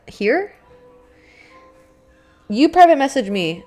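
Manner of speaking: ordinary talk, nothing marked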